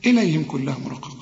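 normal talking voice

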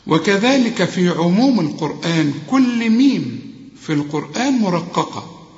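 usual speaking voice

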